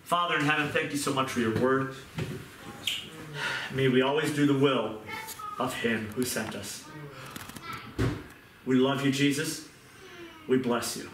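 earnest closing prayer to the Lord. Real, not acted. Pastor closing the sermon in prayer over the congregation, reverent. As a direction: pray it out, reverent and sincere.

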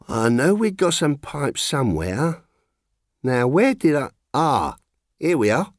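put-on a faded shopkeeper in a light London accent, rummaging; gentle London accent, searching the shelves; lightly common London accent. A narrator's reading. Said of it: "How bad her accent had got".

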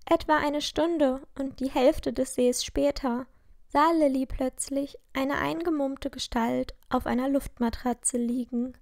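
usual speaking voice

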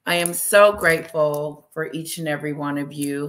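normal talking voice

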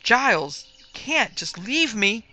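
high, loud terrified plea, slow; despairing plea. A narrator's reading. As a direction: beg him not to abandon you. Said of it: "hopeless, despairing terror".

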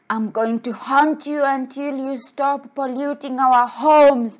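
loud, high haunting threat; high, forceful mock menace; threatening ghost.

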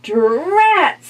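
high, loud frustrated witch; witch cursing. Acted. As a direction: snap it like a cross witch. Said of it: "said the witch".

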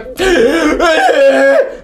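sharp, high gasp as if torn from sleep; startled waking gasp. Retold or imitated. Woken suddenly from the nightmare, a loud high gasp. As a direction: gasp like you've just been pulled out.

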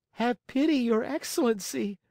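very high, pitiful puppet voice; high-pitched tearful plea; pitiful pleading. A narrator's reading. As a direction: beg like a weeping little puppet. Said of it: "asked in a pitiful voice".

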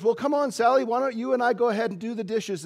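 a cheery grandma calling her granddaughter. Retold or imitated. Grandma inviting Sally to wash dishes, lightly voiced.

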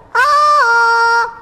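sudden high squeal; high startled yelp. Acted. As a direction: yelp with fright. Sudden fright in the well.

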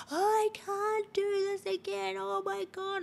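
high, far-off panicked wail, imitated; screaming and crying. Frightened woman at the back of the store, panicked high wail. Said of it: "she was screaming and crying".

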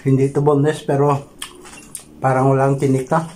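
speaking with food in mouth; words slightly muffled by food; talking while chewing. Real, not acted. Seen 1: Pursed, working lips while holding fish; eating throughout the stretch.

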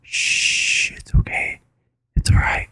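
hushed soothing whisper; calm him down in a whisper. Retold or imitated. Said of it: "he goes".

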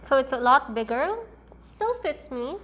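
muffled behind cloth mask; speaking with mask on; through a face mask. Real, not acted. Seen 0: cloth mask covers mouth and nose while speaking, adjusted by hands.

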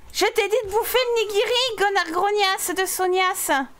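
high-pitched mock tirade; playful high-voiced bullying; mock scolding. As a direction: scold her in a high, hammed-up voice.

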